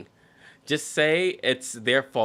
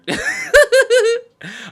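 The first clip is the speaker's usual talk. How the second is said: howling laugh at the absurd story; loud high laugh; 12 dB louder than usual. Real, not acted. Bursts out laughing.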